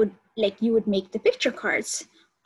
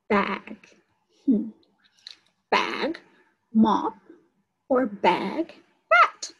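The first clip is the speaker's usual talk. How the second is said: stretched words, rising on the last; slow sound-out; much slower than usual. Shown as a demonstration. Teacher to preschoolers, slowly sounding out words. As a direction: sound out each word slowly for the class. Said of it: "I'm going to say it slowly".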